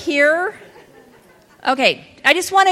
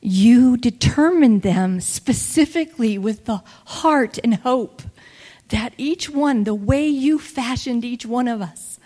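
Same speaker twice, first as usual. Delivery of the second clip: prayer; 4 semitones lower, 4 dB quieter than usual. Acted. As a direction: pray gently, almost whispering. Slow prayerful preaching, whispered.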